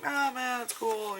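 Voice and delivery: high, sing-out polite refusal; casual brush-off. Retold or imitated. Shopper declining an airbrush demo, high casual brush-off. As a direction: act out breezily waving an offer away. Acts out waving off a booth offer.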